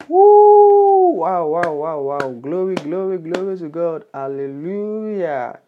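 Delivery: loud, high, joyful woo and glory shouts; rejoicing preacher shouting praise; jubilant praise. Real, not acted. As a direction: burst out in celebration. Celebrating a new convert.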